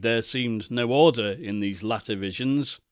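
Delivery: plain reading voice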